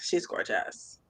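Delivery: whispered, breathy swoon; hushed admiration. Real, not acted. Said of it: "I had to say it like that".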